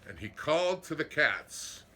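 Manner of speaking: plain reading voice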